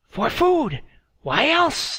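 high, loud cackling crone; old woman crone. A narrator's reading. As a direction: snap it out like an old hag. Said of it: "the old woman replied".

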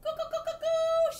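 very high, very loud coo calls; loud hen call. A narrator's reading. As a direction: call the hen at the top of her voice. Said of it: "at the top of her voice".

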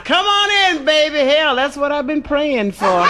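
high, excited shout of a lustful parrot; delighted high parrot cry; eager parrot. Acted. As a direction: crow it out like your prayers came true. Priest's parrot eager reply.

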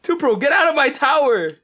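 loud playful yell; shouting. Real, not acted. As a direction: yell it at a teammate. Player yelling at teammate in game.

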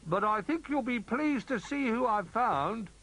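high, loud announcing call; storybook character calling to friends. A narrator's reading. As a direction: call out good news in a high voice. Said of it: "he called".

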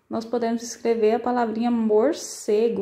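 ordinary talk

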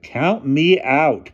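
high, loud, drawn-out refusal; chorused refusal. A narrator's reading. Whole table refuses the night job, drawn-out chorus. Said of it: "chorused everybody".

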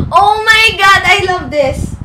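high, loud gushing; loud fangirl delight; delighted exclaiming. Real, not acted. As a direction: burst out in adoration. Delighted by the album.

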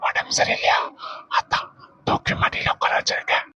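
soft husky whisper; husband whispering huskily to his sulking wife. Acted. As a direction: whisper it low and husky to tease your wife. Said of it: "husky வாய்ஸ்ல பேசிக்கிட்டு".

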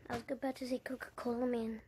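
ordinary delivery

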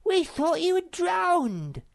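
high, bossy little creature giving orders; bossy little Sniff. Acted. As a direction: play the self-important path pioneer, pitched high. Sniff voiced in story.

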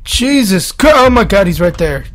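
high, startled yelp; sudden high-pitched jolt of alarm; startled fright. Real, not acted. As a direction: blurt it out as he suddenly appears. Monster suddenly appears in game.

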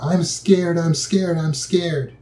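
put-on high, frightened child's cry, repeated; scared child calling out. Child in bed calls out to parent, mock frightened. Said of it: "they're yelling out".

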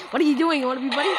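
loud what-are-you-doing demand. Real, not acted. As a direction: push the question at him. Confronting Red, louder.

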